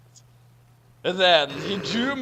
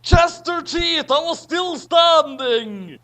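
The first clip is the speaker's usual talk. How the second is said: dramatic; 9 semitones higher, 7 dB louder than usual. Acted. Dramatic reveal.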